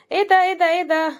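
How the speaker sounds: a sudden high, loud shriek of surprise; real surprise. Real, not acted. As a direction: cut yourself off, thrilled at the find. Interrupts herself finding ten pounds. (صرخة دهشة حقيقية عالية)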